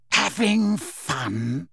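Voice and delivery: very slow, drawn-out menace; menacing. A narrator's reading. Snape catching Harry in his memory, slow menace. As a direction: stretch each word menacingly.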